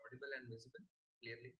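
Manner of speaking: faint, far-off voice on a weak mic; very soft, barely heard over the line; barely audible. Real, not acted. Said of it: "Your voice is very soft".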